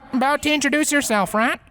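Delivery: lightly Southern, encouraging; mild Southern accent, coaxing. Acted. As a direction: nudge her along in a Southern accent. Earth pony spirit speaking.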